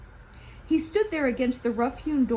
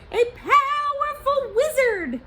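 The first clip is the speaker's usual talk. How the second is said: high, slow, louder awe; awed Gozan; 7 dB louder, slower than usual. Acted. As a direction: lean on the wizard's power. Gozan describes the shaman.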